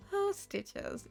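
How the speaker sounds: high, soft cooing. Real, not acted. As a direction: coo at something adorable. Cooing at the cute character.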